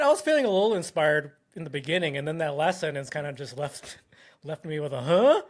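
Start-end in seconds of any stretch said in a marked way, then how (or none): 5.0-5.4 confused huh. Retold or imitated. Imitates confused reaction.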